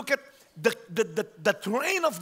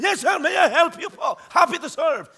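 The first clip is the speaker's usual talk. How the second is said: higher and louder, bright eager service voice; eager store clerk; 7 semitones higher, 8 dB louder than usual. Retold or imitated. A shopper mimics an overeager mall clerk greeting customers, chirpy and loud. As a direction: play the over-keen new clerk on day one.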